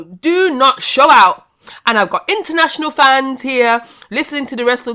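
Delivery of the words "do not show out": stern warning. Real, not acted. Scolding fans, warning them.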